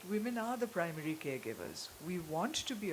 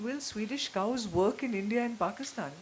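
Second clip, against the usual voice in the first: shocked question; 3 semitones higher, 7 dB louder than usual. Retold or imitated. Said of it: "And I was completely shocked".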